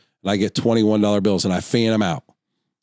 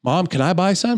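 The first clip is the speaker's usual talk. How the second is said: put-on high-pitched child asking Mom; imitating a kid; 9 semitones higher than usual. Kid asking his mom to buy, high imitated child voice. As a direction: lift it up like an eager kid's request. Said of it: "had a kid sitting next to her".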